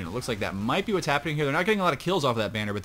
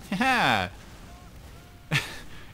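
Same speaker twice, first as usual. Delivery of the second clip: one burst of laughter, trailing off; 7 semitones higher than usual. Real, not acted. Caster cracking up after congratulating team, laughing. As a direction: laugh it out, higher than talk.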